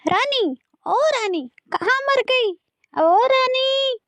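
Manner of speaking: high-pitched, impatient calls; calling out. Acted. Stepmother summons the girl, calling out angrily. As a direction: summon crossly, drawing out the last name. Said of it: "रानी को जोर-जोर से आवाज लगाने लगती है".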